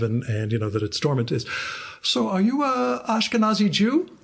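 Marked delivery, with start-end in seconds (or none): 2.0-4.1 high Polish accent, imitating the oncologist. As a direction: mimic a Polish doctor asking. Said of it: "In this Polish accent".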